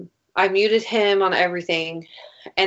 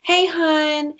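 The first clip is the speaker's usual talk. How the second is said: higher mock-sweet needy voice; mocking clingy guy; 7 semitones higher than usual. Mocking the pushy guy's texts to her, higher mock-sweet. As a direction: parody his pestering texts, sing-song. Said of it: "And he's like".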